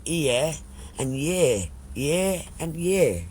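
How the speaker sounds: "ear" and "year" said almost identically, each on its own; sound-alike words. Shown as a demonstration. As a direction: draw out "ear" and "year" like twins. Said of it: "two words that sound the very same".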